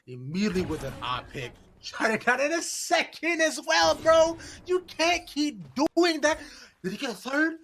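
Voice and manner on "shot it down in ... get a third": high, loud hype yell; excited shouting. Real, not acted. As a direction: scream it like a hype caster. Caster hyped over triple kill.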